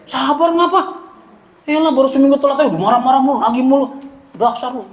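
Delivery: mock angry debtor. Debtor scolding the lender who wants repayment, mock indignant and high. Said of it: "galakan yang dia".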